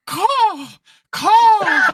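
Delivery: high, hoarse bird caws; wheezing out caws from exhaustion; gasping caws. Acted. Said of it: "I'm huffing and puffing".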